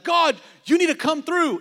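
high pleading believer's voice; whiny demand. Pretend. Pastor mimicking a congregant pleading with God, high and whiny. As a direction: nag God like a spoiled child.

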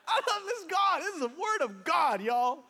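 high, elated burst; delighted exclamation. Real, not acted. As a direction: exclaim with laughing delight to the crowd. Preacher delights in the scripture.

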